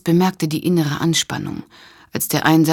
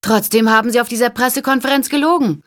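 high, loud and fast call; calling over shoulder; 8 semitones higher, 4 dB louder, faster than usual. A narrator's reading. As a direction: fling it back over the shoulder. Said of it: "rief sie über die Schulter".

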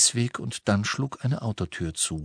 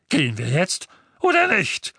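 thundering; 8 semitones higher than usual. A narrator's reading. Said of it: "donnerte Onkel Vernon".

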